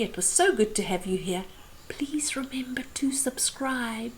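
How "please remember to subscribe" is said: soft hush. Real, not acted.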